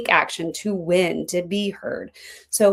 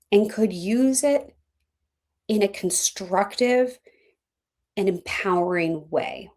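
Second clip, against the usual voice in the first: guided reflection; 3 semitones higher than usual. Real, not acted. Coach asks listeners to sit with their anger, reflective. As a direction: leave long pauses for them to ponder.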